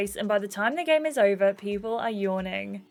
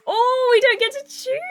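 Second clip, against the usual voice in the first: much higher, louder shocked squeal; surprised squeal; 7 dB louder than usual. Real, not acted. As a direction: shriek in disbelief. Shocked at sleeping arrangement.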